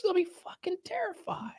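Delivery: breathy mock-spooky whisper. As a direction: drop to a hushed whisper. Spooky aside about scared kids.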